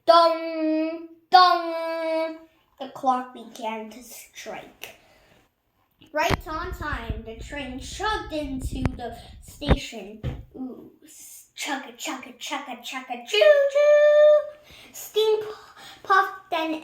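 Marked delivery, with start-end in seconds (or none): 0.1-2.4 loud ringing dong of a clock; booming clock bell. Acted. Clock striking noon. 11.6-14.6 chugga chugga, then a loud high sung choo choo; be the steam train pulling in; train sounds. Acted. Train arriving in the story.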